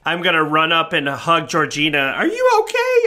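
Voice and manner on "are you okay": put-on worried child. Hugging rescued friend.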